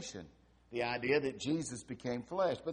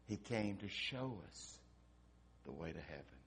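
hushed storytelling; 10 semitones lower, 16 dB quieter than usual. A narrator's reading. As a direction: draw them in quietly at the climax.

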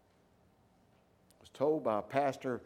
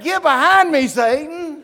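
loud, commanding rebuke; rebuking Satan; 11 semitones higher, 18 dB louder than usual. Acted. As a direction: cast the tempter away with full force. Preacher shouts rebuke at temptation.

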